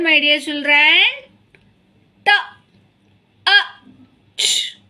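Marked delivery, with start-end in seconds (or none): 2.2-4.8 T, A, ch spoken one sound at a time, each set apart by a pause; sounding out letters. Shown as a demonstration. Teacher sounding out a word for young children, letter by letter. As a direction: break the word into its separate sounds. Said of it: "See the word".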